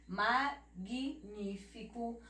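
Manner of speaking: magnífico very slow, "ma-gui-ní-fico"; "magnífico" drawn out, gui set apart; syllable by syllable. Shown as a demonstration. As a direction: break magnífico into separate syllables. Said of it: "Mas não é só o G".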